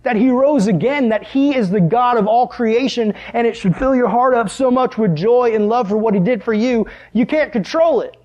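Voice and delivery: swelling, joyful sermon delivery; fervent preaching. Real, not acted. Preaching at full force.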